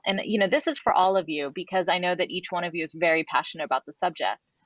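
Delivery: normal talking voice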